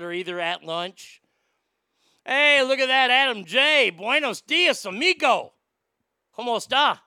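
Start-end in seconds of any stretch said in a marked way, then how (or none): 2.2-7.0 booming across the restaurant, higher and loud; hammed-up loudmouth hailing a buddy; loud greeting. Loudmouth at lunch hailing Adam across the room, booming.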